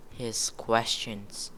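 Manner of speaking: plain reading voice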